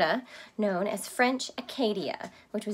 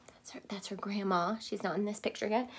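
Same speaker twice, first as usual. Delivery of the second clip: quiet aside; 8 dB quieter than usual. Real, not acted. Aside to children.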